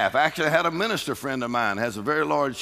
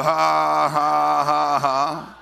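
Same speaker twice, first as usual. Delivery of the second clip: loud scornful ha ha ha; mocking laugh; 4 dB louder, much slower than usual. Preacher laughing at the devil's lies. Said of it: "You go".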